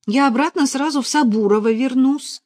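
urgent persuading; insistent. A narrator's reading. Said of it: "настаивала Параша".

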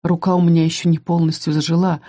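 usual speaking voice

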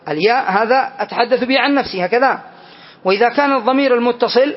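normal talking voice

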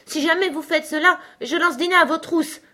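high, loud threatening cry; full-lunged shout. A narrator's reading. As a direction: shout a threat at those outside. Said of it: "s'écria Alice de toute la force de ses poumons". (cri de menace, aigu et fort)

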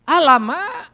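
a loud startled cry of amazement; stunned exclamation. Retold or imitated. Retelling his amazement at the gravestone seller, a loud astonished Alamak.

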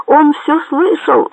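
slow, high-pitched narrated yell; alarmed yell. A narrator's reading. Bandit warning the sailor, high drawn-out yell. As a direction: cry it out high, stretching the words. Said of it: "заорал лось".

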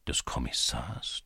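low, soft wondering question; thinking to himself. A narrator's reading. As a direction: ask it inwardly, hushed and low. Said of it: "dachte Levko". (leise und tief in Gedanken fragen)

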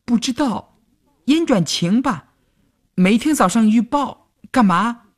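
put-on high, unsure girl's voice; hesitant girl. A narrator's reading. Girl answers the teasing boy hesitantly, high voice. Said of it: "犹豫地说".

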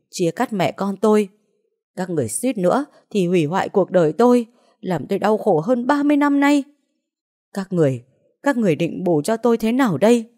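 grieving mother raging at the kidnappers; anguished fury. Acted. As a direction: pour out thirty years of pain, then scream it. Mother's grief turns to rage.

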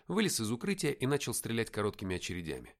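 usual speaking voice

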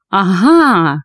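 drawn-out cry of discovery; exclamation. A narrator's reading. Doctor spotting a trap, triumphant exclamation. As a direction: stretch it out like catching someone out. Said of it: "воскликнул он".

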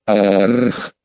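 deep, growling beast roar; furious monster roar. Acted. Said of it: "Яростный рёв монстра".

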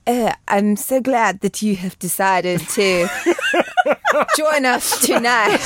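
slow, laboured fake English accent; hammed-up, effortful attempt at an English accent; Keanu Reeves's bad English accent. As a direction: struggle through a badly faked English accent, slowly. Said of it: "this is how he sounds".

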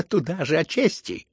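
loud, high jeering cry; spiteful shout. A narrator's reading. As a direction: throw it at him with spite. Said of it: "крикнул он злобно".

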